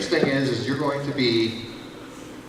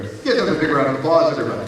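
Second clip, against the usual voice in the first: ordinary delivery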